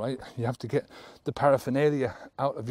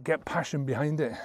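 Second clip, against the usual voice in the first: usual speaking voice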